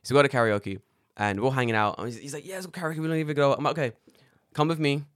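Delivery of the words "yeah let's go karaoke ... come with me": ordinary talk, nothing marked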